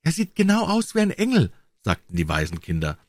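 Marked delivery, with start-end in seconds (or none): 0.0-1.5 put-on much higher, childlike storybook voice; high-pitched children chiming in; orphan children. A narrator's reading.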